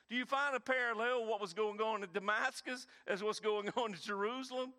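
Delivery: ordinary talk, nothing marked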